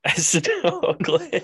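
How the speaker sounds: high, loud, drawn-out amused denial; amused exclamation. Real, not acted. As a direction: blurt it out slowly through laughter. Laughing reaction to a guess.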